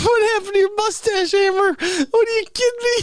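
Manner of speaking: high, laughing through the words; breaking up laughing. Real, not acted. Comic breaking character, laughing at himself. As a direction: lose it and laugh while talking. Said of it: "I'm breaking character twice tonight".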